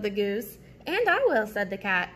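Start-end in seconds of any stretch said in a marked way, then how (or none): 0.9-1.5 high, loud eager chirp; eager cat. Acted. Cat eagerly claims some bread, high and loud. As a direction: pipe up keenly for the bread.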